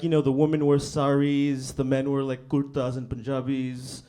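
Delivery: usual speaking voice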